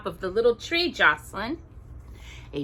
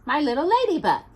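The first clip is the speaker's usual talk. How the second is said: tender; 8 semitones higher, 3 dB louder, faster than usual. Real, not acted. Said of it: "I'd like to say it".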